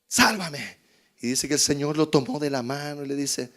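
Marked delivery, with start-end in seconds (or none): none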